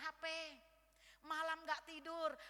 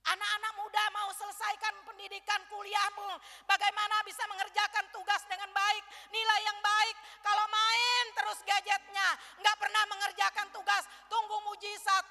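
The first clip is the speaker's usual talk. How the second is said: suara khotbah keras dan lantang; berkhotbah berapi-api; 6 semitones higher, 14 dB louder than usual. Acted. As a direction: tegur anak muda dengan semangat penuh. Preaches loudly to youth. (pengkhotbah menegur anak muda dengan keras dan berapi-api)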